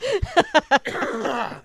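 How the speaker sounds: hacking cough; real cough. Real, not acted. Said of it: "cough up my medicine".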